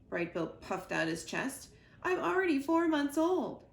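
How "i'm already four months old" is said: high, loud boast; proud gosling. Acted. Gosling boasts to his mother about his age, high and loud. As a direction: puff yourself up as you say it.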